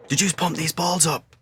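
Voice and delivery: high, slow imitation of an irate coach; strained mock-shout interrogation; Bobby Saxton. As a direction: put on the coach's high, demanding voice. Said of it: "his voice, this was his voice".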